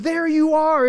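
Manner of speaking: loud, high cry of recognition; joyful exclamation. Acted. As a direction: burst out with ecstatic welcome. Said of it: "it's this exclamation of".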